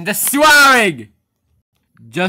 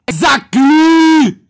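slow, drawn-out yell, much higher and louder; 11 semitones higher, 8 dB louder, much slower than usual. Acted. Boss yelling at a worker over the swearing rule, slow loud yell. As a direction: stretch out a furious shout. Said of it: "Why you have to yell like that?".

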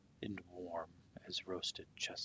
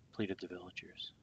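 ordinary delivery, nothing marked

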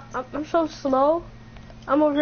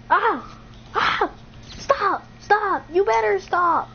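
higher, short cries of protest; alarmed protest; 5 semitones higher, slower than usual. Real, not acted. Player under attack telling friend to stop, high-pitched protest. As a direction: yelp it, then plead for it to stop.

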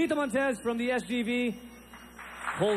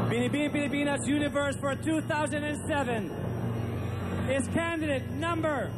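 pageant host; 4 semitones higher than usual. Acted. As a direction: build to the big name. Announcing Miss Universe winner.

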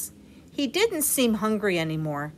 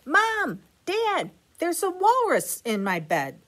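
put-on high, eager little boy calling; excited child voice; 10 semitones higher than usual. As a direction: call out like an excited kid. Child calls to parents.

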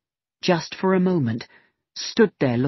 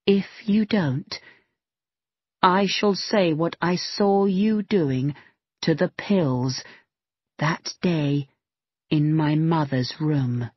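slow, deliberate, each word weighed; very slowly; slower than usual. Acted. Megan slowly threatening Symington with what she saw, slow and deliberate. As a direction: spell out the threat slowly, letting it land. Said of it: "went on very slowly".

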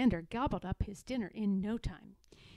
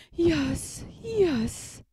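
put-on high, loud sighing old sow; sighing yes; 5 semitones higher, 6 dB louder than usual. Aunt Pettytoes sighing over her piglets, high and loud. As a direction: sigh it out like a weary mother pig. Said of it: "sighed Aunt Pettytoes".

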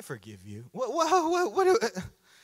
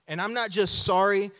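usual speaking voice

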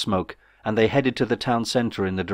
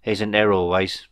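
usual speaking voice

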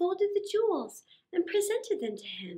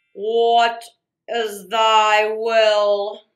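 much louder, slow, lower genie voice; booming genie; 5 semitones lower, 10 dB louder, much slower than usual. Acted. The genie answers Aladdin's rubbing, booming and slow. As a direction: boom from inside the lamp like a spirit.

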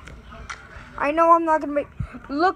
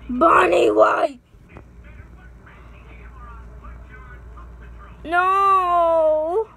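scared yell; much slower than usual. Real, not acted. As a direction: cry out as the jump scare hits. Jump scare in horror game.

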